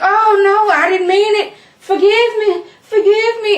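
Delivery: high, loud mock plea for mercy; hammed-up desperate pleading; panicked begging. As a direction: beg frantically in an exaggerated panic. Acted terrified plea to Satan's son.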